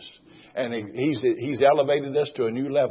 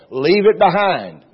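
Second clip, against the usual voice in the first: emphatic exhortation; 5 semitones higher, 8 dB louder, slower than usual. Real, not acted. Preacher exhorting congregation.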